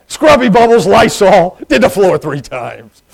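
loud, high start, then slowing; hammed-up pride, punching out each item; proud boast. Toilet cleaner listing his work to visitors, comically proud.

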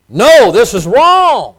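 loud, slow, high stern rebuke; father laying down the law to his sons; firm rebuke. Retold or imitated. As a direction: put your foot down, loud and slow. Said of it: "He didn't speak strong enough".

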